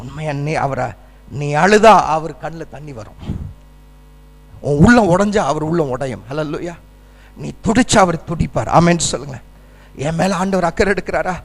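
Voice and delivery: low, hushed words of comfort; tender preaching. Real, not acted. Tender assurance of God's compassion.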